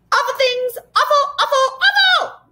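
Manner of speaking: very high, loud creature squawk; yelled mocking echo. A narrator's reading. Furry horde mocking the boy's words, yelling. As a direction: shriek it back, climbing with each word. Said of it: "yelled the furry horde".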